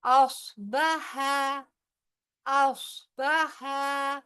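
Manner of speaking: अस्बहा with a heavy, thick sad; slow chant with a thick sad; tajweed recitation. Shown as a demonstration. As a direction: raise the tongue root for a heavy sad in अस्बहा. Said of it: "स्वाद जो है heavy word में से है".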